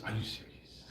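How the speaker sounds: whispered in stunned disbelief; hushed disbelief. Retold or imitated. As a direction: breathe it out, stunned. Disbelief at God's order.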